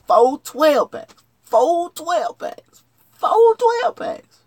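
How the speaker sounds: slow, high, loud, stretched out; hyped-up deal. Real, not acted. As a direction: savour each word like a big bargain. Hyping the soda deal.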